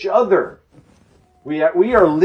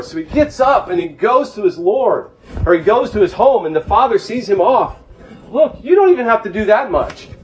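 fervent preaching; 7 semitones higher than usual. Acted. Preacher pleading with the congregation, fervent and raised. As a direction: drive it home to the flock. Preaching mercy at full force.

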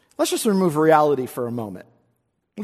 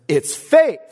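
a declared answer, raised; resounding answer; 5 semitones higher, 5 dB louder than usual. Shown as a demonstration. Preacher proclaiming the author's answer, resounding. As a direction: ring it out, loud and sure. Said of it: "says with a resounding answer".

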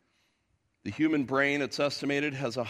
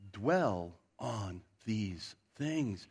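solemn slow emphasis; 5 semitones lower, 6 dB quieter, much slower than usual. Acted. Preacher quotes Paul's punchline.

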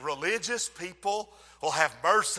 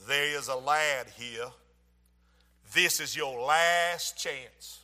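low, slow Mississippi drawl; Mississippi accent; 6 semitones lower, 4 dB quieter, slower than usual. Retold or imitated. Visiting preacher warning a lad from the steps, Mississippi accent. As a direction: imitate a Mississippi preacher, deep and softer. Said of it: "with his Mississippi accent".

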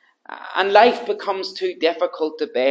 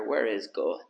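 quiet despair; 8 semitones lower, 7 dB quieter than usual. Acted.

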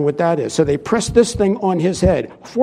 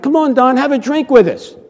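coaxing; 6 semitones higher, 4 dB louder than usual. Retold or imitated. Friends pressuring preacher to drink.

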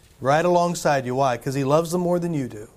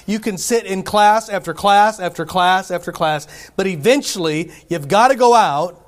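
louder, rising preacher's push; preaching; 2 semitones higher, 4 dB louder than usual. Acted. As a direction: drive the point home to the congregation. Preaching builds to full force.

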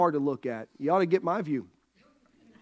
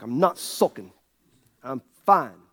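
low, curt sulky retort; mock sulky denial; 7 semitones lower than usual. Husband answering his wife about sulking, in a low mock sulk. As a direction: deny it in a low sulk, acted out. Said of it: "Will you stop sulking?".

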